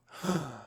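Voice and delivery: a sharp, high, playful gasp of fake offense; a hammed-up shocked intake of breath; mock gasp. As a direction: gasp as if scandalized, but teasing. Mock offense at being teased.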